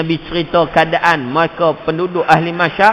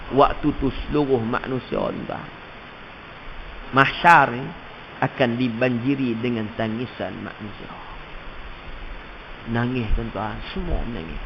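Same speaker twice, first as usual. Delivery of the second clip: hushed preaching; 5 semitones lower, 6 dB quieter than usual. Acted. Hushed solemn sermon.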